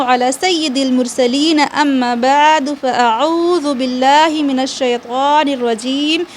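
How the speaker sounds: melodic Quranic-style recitation with lengthened vowels; Arabic recitation. Acted. Teacher opening lesson three with praise, melodic Arabic recitation.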